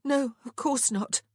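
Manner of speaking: high, loud, slow bitterness; bitter. A narrator's reading. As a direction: agree bitterly, pitched high. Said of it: "Prudence said bitterly".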